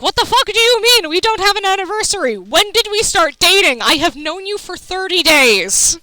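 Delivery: high, loud incredulous yell; mock exasperated outburst. Bard yelling at the sorcerer, mock exasperated and high. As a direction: blurt it out high and loud in disbelief.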